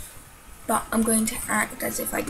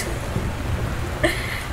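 nervous laugh. Real, not acted. Laugh after the jump scare.

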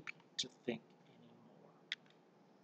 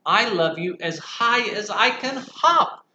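high, loud bouncy bunny; a touch of laughter in the little hare; laughing little hare; 8 semitones higher, 9 dB louder, slower than usual. Acted. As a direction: cheer while hopping. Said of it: "laughed Little Nut Brown Hare".

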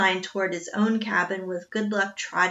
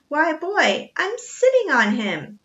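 grinning; 7 semitones higher, 5 dB louder than usual. A narrator's reading. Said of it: "The mountain man grinned".

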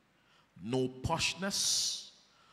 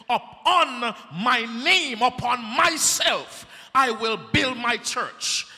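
preaching; 6 semitones higher, 8 dB louder than usual. Acted. Preacher voices Jesus.

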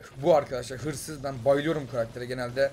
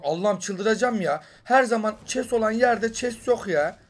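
exasperated; 5 semitones higher, 4 dB louder, slower than usual. Real, not acted. No chest found again.

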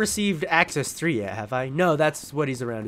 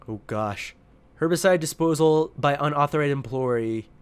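normal talking voice

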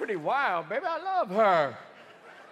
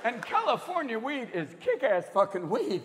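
ordinary talk

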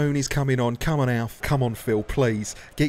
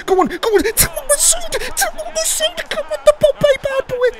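much higher, straining squeal of encouragement; frantic high shouting; 3 dB louder than usual. Real, not acted. Commentator begging his striker to score, frantic high squeal. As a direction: scream him on like it's the last chance. Last minute chance on goal.

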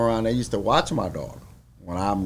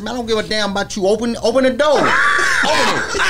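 fast, higher, shouting swagger; 10 semitones higher, 7 dB louder, faster than usual. Retold or imitated. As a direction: yell at the guard to open up. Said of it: "he didn't change his character".

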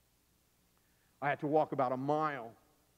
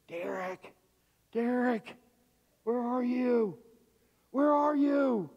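drawn-out search call, growing higher and louder; calling from a distance; 9 semitones higher, 6 dB louder, slower than usual. Retold or imitated. Dad searching for his lost son, calling out. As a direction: holler for a lost child, building to a high shout. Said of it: "suddenly I hear in the distance".

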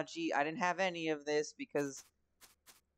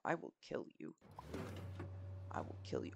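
hushed, breathy mock threat; a playful death threat under the breath; whispered threat; 6 semitones lower, 9 dB quieter than usual. Player threatening the game, mock whisper.

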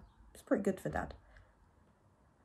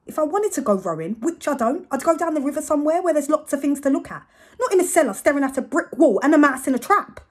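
building rant; 10 semitones higher, 11 dB louder than usual. Acted. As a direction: pitch climbs higher and louder through the rant. Narrator's escalating rant about rowing.

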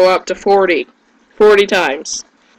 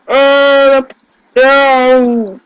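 sudden loud alarmed "no", higher; panicked cry; 6 semitones higher, 4 dB louder than usual. Real, not acted. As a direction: yelp it as the monster hits. Attacked by monsters in game.

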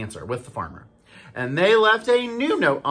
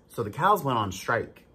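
plain reading voice

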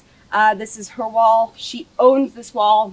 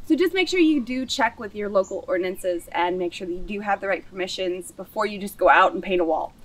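normal talking voice

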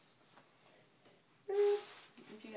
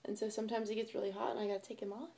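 ordinary talk

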